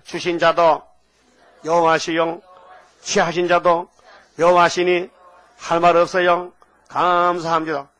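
loud, measured recitation for the congregation to echo; recitation to repeat. Shown as a demonstration. Pastor leads the congregation in reciting Job's words, loud and measured. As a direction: proclaim it line by line, firmly. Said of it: "따라하시오".

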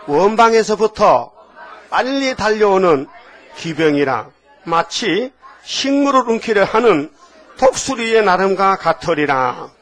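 slow, emphatic verse in short phrases; scripture call-and-repeat. Shown as a demonstration. Preacher leads the congregation repeating a verse, slow and emphatic. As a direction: give it out slowly for others to repeat. 따라하시오.

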